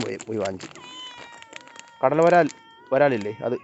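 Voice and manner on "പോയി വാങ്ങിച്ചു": normal talking voice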